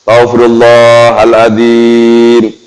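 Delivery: drawn-out, unhurried chant of astagfirullahaladzim; slow devotional recitation. Shown as a demonstration. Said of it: "Pelan-pelan boleh".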